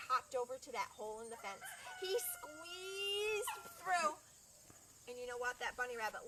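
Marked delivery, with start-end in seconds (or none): 2.0-4.2 strained squeeze. Acted. Bunny squeezes through fence.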